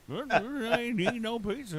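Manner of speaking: high, slow imitation of his brother denying it; mock defensive denial. A brother mockingly denying the pizza, high and slow. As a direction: play the brother, whining that he ate nothing.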